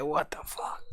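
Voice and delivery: unvoiced whisper of his line, teasing; whispered imitation. Streamer whispering his partner's curse back at him, teasing. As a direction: breathe it back to him as a joke. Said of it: "He said".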